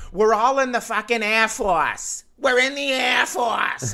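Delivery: put-on loud, high, drunken Boston holler; hammed-up drunk Boston woman; thick Boston accent. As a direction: bellow indignantly in a mock Boston accent. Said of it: "a thick Boston accent".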